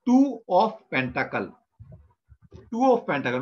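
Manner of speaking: slow, spaced-out "two of Pentacle" for learners; pronunciation demo. Shown as a demonstration. Teacher showing what the second card is called, slow pronunciation demo. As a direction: say "two of Pentacle" slowly, each word set apart. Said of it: "तो उसको बोलेंगे".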